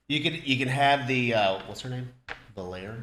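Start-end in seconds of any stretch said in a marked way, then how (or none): 2.6-3.0 "Valier" ending like "air", as a question; unsure pronunciation. Shown as a demonstration. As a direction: try "Valier" tentatively, rhyming it with "air". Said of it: "we don't know if we're saying that right".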